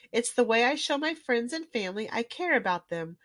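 ordinary delivery

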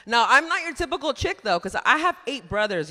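normal talking voice

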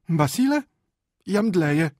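very high, loud wail; shrill theatrical distress; melodramatic wife. Acted. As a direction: threaten to swoon for effect. Wife threatens to faint.

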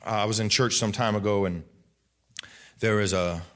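ordinary delivery

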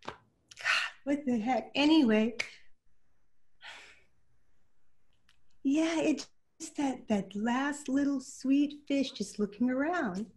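fighting back tears while talking about the fish; real emotion breaking through; getting emotional. Real, not acted. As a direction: voice catching, choked up. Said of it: "I get emotional".

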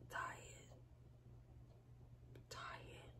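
barely voiced, trailing off; fading whisper. Real, not acted. Venting alone to camera, fading whisper. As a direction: let each word die away.

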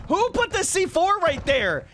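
high, slow, stretched-out demand; indignant surprise. Real, not acted. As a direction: exclaim in startled outrage. Startled by a planted explosive.

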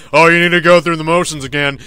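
hammed-up scolding; mocking the mentor. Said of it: "through his, like".

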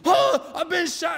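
high-pitched, hammed-up yell of a wounded man; yelling gunshot victim. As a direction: act out a panicked cry for help. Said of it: "and he's yelling".

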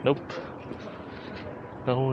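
ordinary talk, nothing marked

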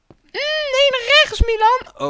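very high, loud squealed correction; mock damsel losing patience; exasperated falsetto princess. Princess correcting Milan's route, exasperated falsetto.

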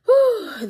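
high, loud exhale of relief; relieved whew. Real, not acted. Relief as the phone is picked up, loud whew. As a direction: let out a big whew.

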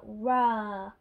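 loud, drawn-out pretend roar; mock dinosaur roar. A narrator's reading. Little George asks for a dinosaur, mock roar.

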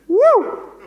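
high, loud whoop imitation; piercing mock whoop; Marty. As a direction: let out a big high "woo" mimicking someone. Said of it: "I'll do a Marty".

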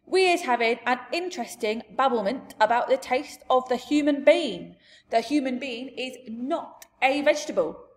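big, emphatic giant voice; indignant giant. Acted. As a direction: lecture her as an indignant giant. Giant lectures Sophie in story.

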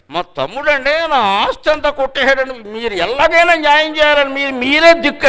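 imitating a pleading client, high and slow; a hint of a wronged brother begging.

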